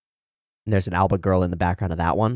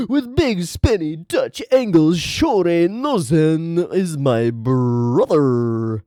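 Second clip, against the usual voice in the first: slow, loud, high dramatic announcement; melodramatic reveal; 8 semitones higher, 5 dB louder, much slower than usual. Pretend. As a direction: ham up the big twist.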